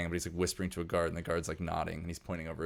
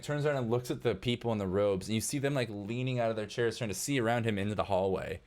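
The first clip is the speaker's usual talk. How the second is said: ordinary delivery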